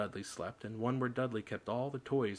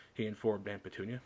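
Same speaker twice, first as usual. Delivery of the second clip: ordinary delivery, nothing marked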